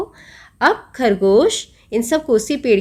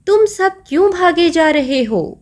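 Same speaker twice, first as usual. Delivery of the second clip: high, raised demanding question; lion questioning; 8 semitones higher than usual. Acted. Lion asks the fleeing animals why they run, high and demanding. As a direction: demand an answer from the runners.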